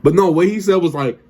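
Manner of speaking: ordinary talk, nothing marked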